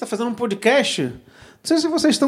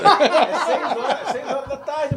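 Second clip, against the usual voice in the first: laughing; 5 semitones higher, 4 dB quieter, slower than usual. Real, not acted. Laughing about the late hour.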